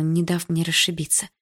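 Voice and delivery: ordinary delivery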